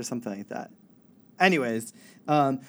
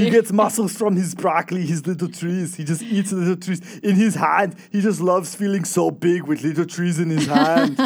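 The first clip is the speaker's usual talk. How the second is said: mock Schwarzenegger; 4 semitones higher, 5 dB louder than usual. Mocking Arnold eating broccoli.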